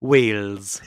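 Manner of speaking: a single word, Wales, said in a Welsh accent. Shown as a demonstration. Asked by his co-host to repeat it, he says Wales in a Welsh accent. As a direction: say Wales the way a Welsh person would. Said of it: "Give it again".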